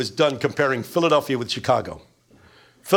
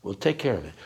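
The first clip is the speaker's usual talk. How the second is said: low Godfather reply; 8 semitones lower, 5 dB quieter than usual. Retold or imitated. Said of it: "says the Godfather".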